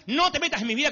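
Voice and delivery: put-on teen complaint, rising. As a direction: pitch the no up in protest. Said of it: "nos dicen esas cosas".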